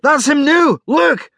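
high, excited shout; loud announcement. Acted. Rory points the others to the screen, excited shout. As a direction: shout it out, pitched right up. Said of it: "said Rory loudly".

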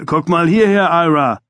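a photographer calling for attention from behind the lens. A narrator's reading. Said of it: "rief Ray hinter dem Objektiv".

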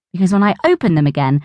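usual speaking voice

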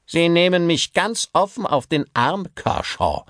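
bristling with rage at being mocked; angry director. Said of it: "knirschte vor Wut". (wütender Theaterdirektor)

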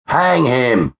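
high-pitched crowd retort; narrated shout from the crowd; mob cry. A narrator's reading. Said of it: "was the only reply".

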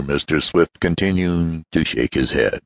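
usual speaking voice

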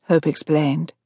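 plain reading voice

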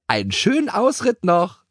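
high pony call; whinnying farewell. A narrator's reading. Keks to the scattered horses, whinnying farewell. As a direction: neigh a cheeky goodbye. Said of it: "wieherte Keks".

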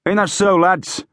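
high-pitched call to the ranks; rally the men with a question. A narrator's reading. Officer calls out to the men.

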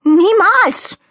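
high, loud protest; shouted denial. Acted. Donald Rabbit denying he ate fifteen, shouting. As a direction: cry out in outraged denial. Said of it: "rief er".

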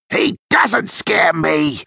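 slow, high, defiant bluster; understated shout in a put-on crook's voice; mild shout. A narrator's reading. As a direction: act brave at no great volume. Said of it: "shouted Daniel".

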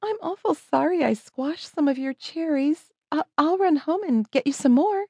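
put-on high, girlish apology; apologetic young girl. A narrator's reading. Young girl apologizes for squashed cherries.

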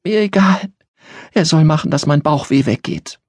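put-on a sick child insisting on help; a child's stubborn outburst; defiant little girl. A narrator's reading. Christina insists.

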